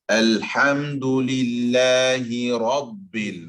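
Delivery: slow, drawn-out chant of Alhamdulillahi Rabbil; model recitation. Shown as a demonstration. Teacher modelling a verse for a student, slow recitation. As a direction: recite Alhamdulillahi Rabbil slowly with long held vowels. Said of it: "not Rabbil, Rabbil".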